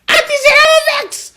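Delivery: high, loud cry; mock cry of Auntie Sarah Vex; shouted catchphrase. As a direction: belt out the catchphrase.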